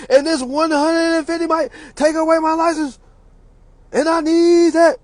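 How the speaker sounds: high, whiny pleading. Retold or imitated. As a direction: beg the judge in a whine. Mocking pleading defendant.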